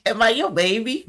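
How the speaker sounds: put-on high, cutesy girlfriend voice; girly baby voice. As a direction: coo it like a clingy girlfriend. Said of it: "Not sounding like that".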